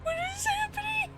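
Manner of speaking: squeaky, stunned disbelief; can't believe it, squeak it out; high disbelief. Real, not acted. Overwhelmed by gift subs.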